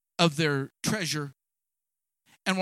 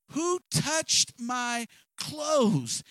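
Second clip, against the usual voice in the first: slow, raised call of Who; 6 semitones higher, much slower than usual. Acted. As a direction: lift it up and call out slowly, Who touched. Repeated louder, searching crowd.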